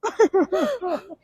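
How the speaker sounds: high-pitched burst of laughter; real high laugh. Real, not acted. Laugh after smack memory.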